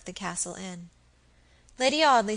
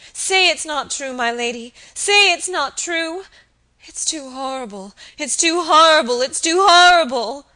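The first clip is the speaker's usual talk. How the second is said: high, loud, desperate pleading; wild appeal; 7 semitones higher, 12 dB louder than usual. Acted. Kneeling maid begging her mistress, desperate loud plea. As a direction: beg wildly, rising to a cry. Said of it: "appealing wildly to Lady Audley".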